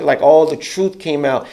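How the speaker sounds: ordinary delivery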